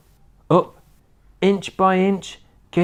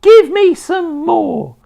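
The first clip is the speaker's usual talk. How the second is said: very high, very loud screechy crone whoop; wild old woman's shout; 7 dB louder, slower than usual. A narrator's reading. Grandma rising through the floor, screeching with glee. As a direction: whoop with mad joy.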